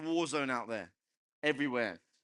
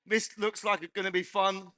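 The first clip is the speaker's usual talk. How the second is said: ordinary talk, nothing marked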